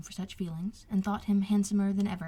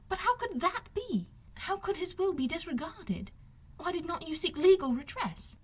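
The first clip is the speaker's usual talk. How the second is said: put-on higher, incredulous questioning young woman; indignant young lady; 9 semitones higher than usual. Elizabeth questioning Wickham, higher and incredulous. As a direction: press him, unable to believe it.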